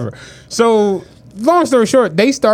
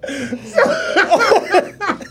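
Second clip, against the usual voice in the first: wheezing high laughter; long high laugh; 10 semitones higher, 3 dB quieter than usual. Real, not acted. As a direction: laugh hard, high and breathless.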